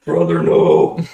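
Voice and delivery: slow, loud anguished cry; overacted death scene. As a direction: ham up a dying brother's wail. Mock dramatic death cry.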